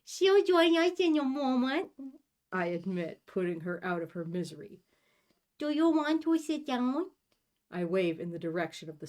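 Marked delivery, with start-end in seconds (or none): 0.1-1.9 high, louder put-on ooh voice. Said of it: "ooh, ooh voice". 5.6-7.1 high put-on ooh voice. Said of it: "ooh, ooh voice".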